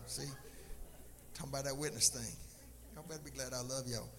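ordinary delivery

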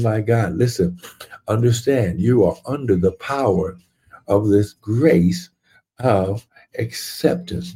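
ordinary delivery

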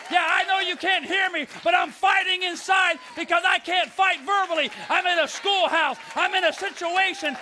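building to high, shouted declaration; fiery warrior preacher, climbing to a shout; full-force preaching. Acted. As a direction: preach an inner battle cry out loud. Acts out inner battle cry, preaching.

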